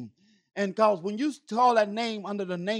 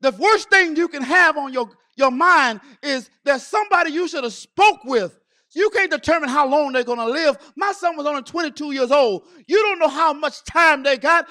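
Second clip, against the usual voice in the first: urgent, raised, loud exhortation; full-force preaching; 4 semitones higher, 6 dB louder than usual. Real, not acted. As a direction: press the congregation to act now.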